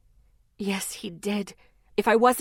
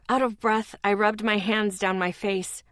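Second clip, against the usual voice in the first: ordinary delivery, nothing marked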